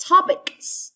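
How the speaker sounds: topics, high TOP and a long s ending; hissed final S. Shown as a demonstration. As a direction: stretch the end of topics into sss. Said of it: "the S must say S".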